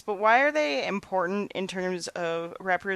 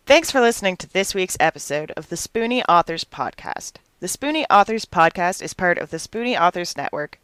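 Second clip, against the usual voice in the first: louder, polished announcer read of the sign-off; podcast outro read; 7 dB louder than usual. Acted. Host signing off listeners, in a louder announcer read. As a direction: read the credits like a host closing the show.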